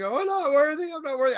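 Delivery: high-pitched, loud mock grovel. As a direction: grovel dramatically, as if flung at someone's feet. Acting out groveling before Harrison Ford.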